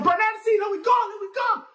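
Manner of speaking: high, loud, hurried urging; urgent shout. Acted. Tukuma shouting at Nancy to leave the garden, high hurried shout. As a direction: yell at him to get moving now.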